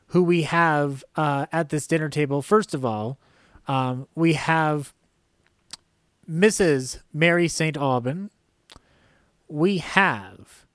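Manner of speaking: normal talking voice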